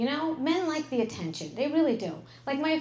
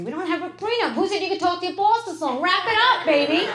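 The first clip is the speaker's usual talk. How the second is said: higher, louder, building to a bossy order; bossy mock command; 6 semitones higher, 6 dB louder than usual. Wife telling husband to stop talking to his boss, bossy and louder. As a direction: order him off the phone, teasing.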